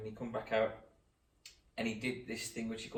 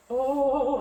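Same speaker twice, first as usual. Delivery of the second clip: loud, high, drawn-out moaning; hammed-up swoon; mock fainting friend; 13 semitones higher, 9 dB louder than usual. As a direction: imitate someone about to pass out, wailing it. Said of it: "all of a sudden he goes".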